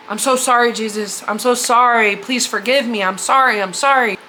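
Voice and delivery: usual speaking voice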